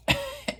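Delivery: high burst of a giggle; a quick squeaky giggle; short high laugh. Real, not acted. Laughing at own recap.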